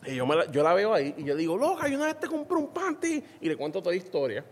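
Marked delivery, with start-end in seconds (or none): 1.6-3.2 mock high quote. Retold quote to the woman.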